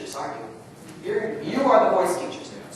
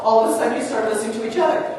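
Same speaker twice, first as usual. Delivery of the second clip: usual speaking voice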